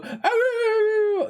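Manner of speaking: high, slow howling "Ah, rah, rah, rah"; imitating a friend's wolf howl; mock wolf howl. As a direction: howl it out like a playful wolf pup. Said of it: "and they go".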